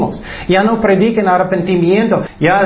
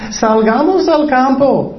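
higher, sweet, luring tone; deceitful invitation; 6 semitones higher than usual. Shown as a demonstration. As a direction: coax him out as if nothing is wrong. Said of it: "engañando".

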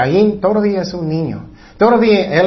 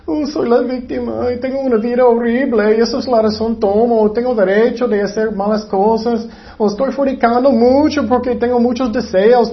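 high, self-pitying mock complaint; mocking a victim in a higher voice; childish victim; 6 semitones higher than usual. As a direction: whine excuses like a sulking child. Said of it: "Eso es un niño".

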